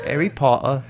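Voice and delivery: echoed fake English accent; playful imitation English accent; mock English accent. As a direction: parrot it back in a put-on English accent.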